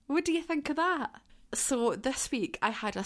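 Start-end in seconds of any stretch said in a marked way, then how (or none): 0.1-1.1 bright high-pitched question to listeners; excited question. Real, not acted. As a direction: pitch it up, eager for their reaction.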